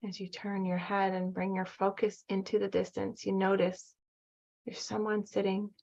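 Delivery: calm, even meditation voice with long pauses; guided meditation. Acted. Guide leading listeners back to their inner child, calm meditation voice. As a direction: guide them gently, leaving room to breathe between phrases.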